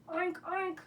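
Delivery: lightly imitated pig grunts; playful spoken oinking for a guessing game; pig oinks. Shown as a demonstration. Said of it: "I'm gonna make this sound".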